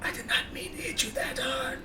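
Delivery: soft breathy apology, barely voiced; whispering. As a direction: whisper it close to his ear. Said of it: "I'm gonna whisper".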